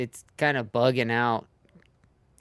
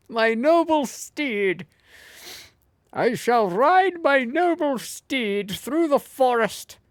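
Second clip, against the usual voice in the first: knight voice; 11 semitones higher, 5 dB louder than usual. Pretend. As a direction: speak like a noble knight. Mock knightly speech to the boar.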